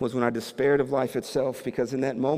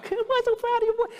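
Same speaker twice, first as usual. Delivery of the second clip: imitated proud cheer. Father cheering son at game.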